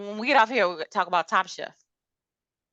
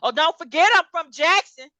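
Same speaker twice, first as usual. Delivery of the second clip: mock mad, higher and louder; Tambra; 7 semitones higher, 7 dB louder than usual. Imitating a cast member when she got mad, mock angry. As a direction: throw it out as an indignant mock warning. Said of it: "when she got mad".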